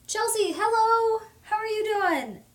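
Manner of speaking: high bright hello; cheery greeting. Real, not acted. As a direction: call out to a friend in chat. Greeting a viewer.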